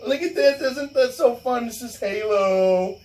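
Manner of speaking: high, eager computer-geek impression, slowing on Halo; nerdy geek voice. Teller mocking the LAN party geeks, high and gushing. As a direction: play a gushing gamer showing off his game. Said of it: "all these computer geeks are like".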